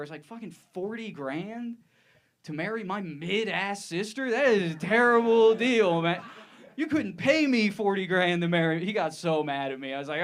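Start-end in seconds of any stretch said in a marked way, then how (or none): 0.2-8.8 higher-pitched, building to loud outrage; mock disbelief. Brother-in-law hears about the wedding cost, mock incredulous outburst. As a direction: react in jaw-dropped comic indignation. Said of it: "My jaw hit the floor".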